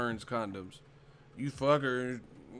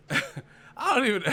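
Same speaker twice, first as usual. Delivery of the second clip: cracking up mid-sentence, high; real laughing; 8 semitones higher than usual. Real, not acted. Streamer cracking up at a brand-name joke, laughing. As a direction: burst out laughing and lose the line.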